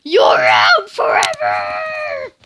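low, slow, booming umpire shout; angry umpire. Retold or imitated. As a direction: bellow it like a furious umpire. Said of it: "the umpires get mad and they're like".

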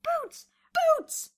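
high, loud call. A narrator's reading. Bruno calling Boots in the chaos, hollering. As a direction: holler for a friend over the din. Calling friend over the din.

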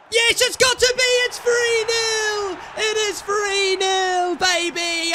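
loud, high shouts, slow and drawn out; goal celebration. Real, not acted. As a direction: savour the third goal.

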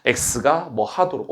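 ordinary talk

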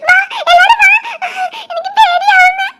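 high laughing shouts; giggly frightened shouting. Acted. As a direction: shriek for them to come, laughing through it. Calling everyone to hide, giggling.

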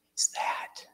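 whispered question at the snake; startled whisper. Retold or imitated. Seeing the snake in the garage, a whispered question. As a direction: hush it out like you've just spotted something.

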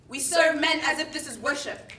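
normal talking voice

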